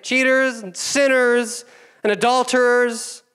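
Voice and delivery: high, loud, self-satisfied praying; smug Pharisee prayer. Acted. Pastor mocking the Pharisee's prayer in a high smug voice. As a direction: preen before God like a proud Pharisee. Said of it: "his beautiful, wonderful, elegant prayer".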